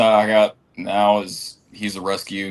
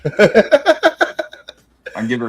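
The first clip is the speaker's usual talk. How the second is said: laughing through the words; giggling while joking; 6 semitones higher, 7 dB louder, slower than usual. Real, not acted.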